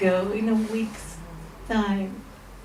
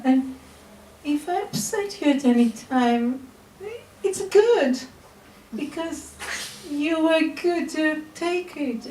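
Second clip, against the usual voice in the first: tender loving words; 6 semitones higher than usual. Retold or imitated. Tells adoptive mother her love.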